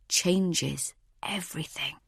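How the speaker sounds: slow, soft near-whisper; quiet, slow dramatic close; hushed revelation. A narrator's reading. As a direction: let it sink in, almost whispered. Hushed revelation ends the chapter.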